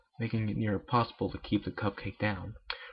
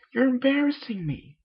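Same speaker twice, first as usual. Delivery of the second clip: embarrassed; 13 semitones higher, 4 dB louder than usual. Acted.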